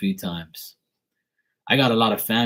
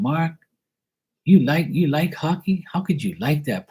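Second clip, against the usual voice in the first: imitating his auntie; 8 semitones higher than usual. Auntie from British Columbia asking her nephew why he likes hockey, higher mock-puzzled voice. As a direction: play the puzzled auntie teasing him about hockey, higher. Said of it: "they used to ask me all the time".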